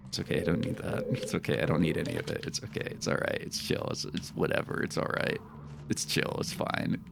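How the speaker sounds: a quiet sulky murmur of it's fine; muttering to himself. Real, not acted. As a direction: mumble it to convince yourself. Mumbled self-consolation.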